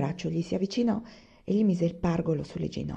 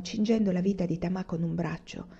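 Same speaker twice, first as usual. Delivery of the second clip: plain reading voice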